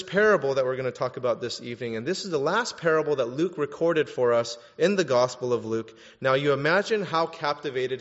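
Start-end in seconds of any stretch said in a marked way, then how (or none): none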